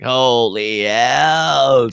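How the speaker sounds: very slow, stretched-out awe; lightly acted stunned reaction; mock amazement. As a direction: drag it out in disbelief. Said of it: "and I was like".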